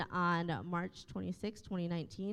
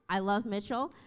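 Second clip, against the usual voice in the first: ordinary talk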